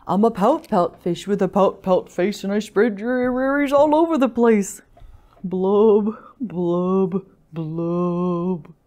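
sing-song mopey fish with drawn-out blubs; glum pout-pout fish. Acted. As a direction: chant the rhyme, sinking into gloom. Gloomy fish's refrain.

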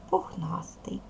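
whispers "пухнастий" to herself while writing; whispered word. Real, not acted. Teacher writing the word on the board, whispered. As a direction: breathe the word as you write it.

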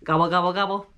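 loud, goofy gobbling turkey call; turkey gobble. Pretend. Song's ending turkey call, loud and goofy. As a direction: gobble like a big fat turkey. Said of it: "I talk like this".

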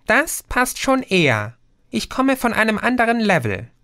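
high, puzzled little being; tiny creature voice. A narrator's reading. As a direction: squeak it like a tiny fairy, puzzled by the word. Creature answers. (hohe, verwunderte Wesen-Stimme)

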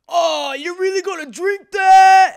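loud, high, drawn-out New York jeer; rowdy Long Island fan impression; imitated heckler yelling. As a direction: shout mockingly from the bleachers. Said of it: "they're yelling and screaming".

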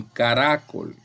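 caRAcol with the weight on RA; stress on middle syllable. Shown as a demonstration. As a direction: lean on the second syllable of caracol: ca-RA-col. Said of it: "ni caracol".

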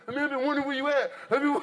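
high, gushing reunion voice, climbing as it goes; mock-emotional lion wailing to an old pal; overjoyed lion. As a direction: gush like a long-lost friend, hammed up. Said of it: "playing the lion like this".

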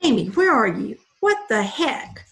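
loud, angry yell for his wife; shouting. A narrator's reading. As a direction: bellow through the house.

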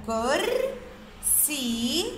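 كرسي very slow, كر and سي far apart; syllable by syllable. Shown as a demonstration. Teacher splitting كرسي into boxes, syllable by syllable. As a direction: hold كر, pause, then a long سي. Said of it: "باخد معاه الحرف اللي سبقه".

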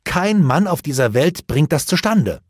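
high, loud burst of indignation; indignant outcry. A narrator's reading. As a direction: cry out, upset and exasperated. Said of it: "rief er aufgebracht".

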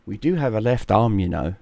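low-voiced inner thought; voice of the brain. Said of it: "Your brain at this point is saying".

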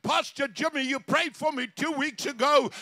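high, excited retelling building to a shout; retold screaming. Healed woman phones her pastor about the cancer, retold excited screaming. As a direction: imitate overjoyed screaming, climbing to a long cry. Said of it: "Velma is crying and screaming".

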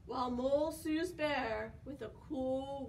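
ordinary delivery

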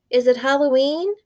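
put-on high, loud little-boy question; a little brother puzzled about a mask; curious little boy. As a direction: ask it like a wide-eyed small child. Little brother asks the babysitter.

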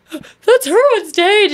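high, slow teary grandma voice; imitating tearful grandma. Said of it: "like, crying".

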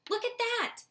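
high-pitched amazed exclamation; excited gasp. Acted. Soren showing his mother the hatching egg, high excited call. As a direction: point it out with a breathless thrill. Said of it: "Soren gasped".

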